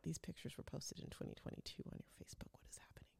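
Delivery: hushed, shocked whisper; alarmed whisper. Retold or imitated. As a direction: whisper it like you've uncovered a scam. Alarmed at breeder's old photos.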